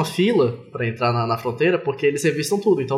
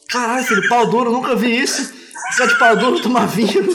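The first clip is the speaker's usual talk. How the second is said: higher, louder, laughing dad voice; stifled giggles; 7 semitones higher, 3 dB louder than usual. Retold or imitated. Father voiced in retold story.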